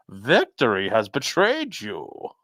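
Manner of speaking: slow, dark movie villain; ominous villain voice. Acted. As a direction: intone it like a brooding villain.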